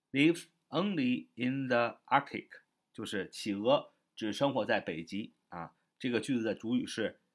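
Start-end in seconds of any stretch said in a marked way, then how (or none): 0.1-2.5 slow, spaced English reading; slow model sentence. Shown as a demonstration. Teacher repeats the example sentence for learners, slow and spaced. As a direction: say it slowly, word by word, as a model. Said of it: "再说一遍".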